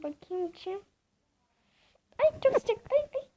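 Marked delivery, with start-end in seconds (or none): 2.2-3.3 yelp from hot soup. Acted. Burning tongue on hot soup.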